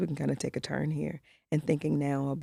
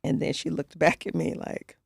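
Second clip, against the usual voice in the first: usual speaking voice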